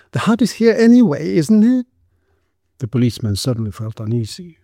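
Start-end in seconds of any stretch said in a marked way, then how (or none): 0.1-1.9 high, questioning woman's voice; shrill. A narrator's reading. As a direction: protest meekly in a shrill, high pitch. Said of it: "in her shrill voice".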